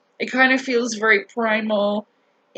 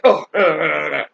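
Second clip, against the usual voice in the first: low, loud, caught-off-guard stammer; flustered stammer; 8 semitones lower, 5 dB louder than usual. Retold or imitated. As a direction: mimic him fumbling for words. Driver caught off guard on phone.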